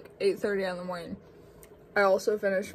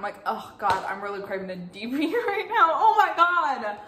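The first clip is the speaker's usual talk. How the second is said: can't keep straight face; 4 semitones higher, 7 dB louder than usual. Acted. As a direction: start mock-earnest, break into laughing, higher and louder. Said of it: "couldn't say that with a straight face".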